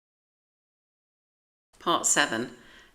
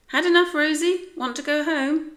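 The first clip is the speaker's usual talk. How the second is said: higher, louder, quick coaxing voice; owner to dog; 11 semitones higher, 7 dB louder than usual. A narrator's reading. George asks his dog Rosie if she wants home, cheery and coaxing. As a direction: coax your dog like an owner.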